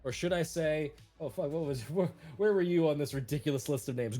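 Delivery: ordinary talk, nothing marked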